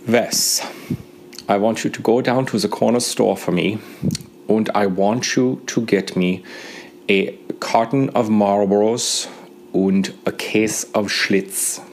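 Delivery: put-on thick German accent, slow and deliberate. Mechanic Dieter asking a kid for smokes, in a German accent. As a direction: mimic a heavy German mechanic. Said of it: "that's the way his accent was".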